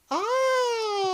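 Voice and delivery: high, drawn-out "ohhh" of wonder; mock-awed squeal; awed child's gasp. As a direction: imitate an amazed little kid's long high coo. Imitating the amazed child.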